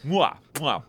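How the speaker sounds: mwah out a pair of mock kisses; exaggerated kissing noises. Host jokily kissing at men friends, exaggerated smooches.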